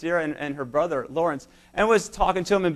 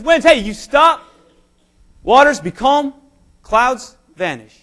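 higher and louder, slow commanding call to the storm; commanding cry; 6 semitones higher, 8 dB louder, slower than usual. Retold or imitated. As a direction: order the winds to stop. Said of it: "he cries out".

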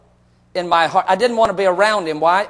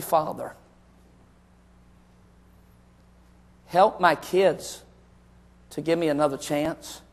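low, soft, slow confession; quiet, halting plea with long pauses; remorseful plea; 5 semitones lower, 6 dB quieter than usual. Retold or imitated. Father confessing his failings in a letter to God, subdued remorse.

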